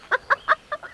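high, amused burst of laughter. Acted. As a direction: laugh at his jealousy. Girl amused by his jealousy. (hoog, geamuseerd lachje)

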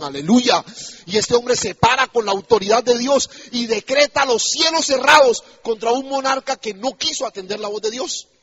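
loud, rising pulpit proclamation; forceful preaching. Acted. Preacher proclaiming the prophet's defiance of King Acab, forceful preaching. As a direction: declare it with mounting force.

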